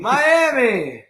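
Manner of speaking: high, bright call back; excited echo. Real, not acted. Kid echoing a friend's shout of Miami, excited.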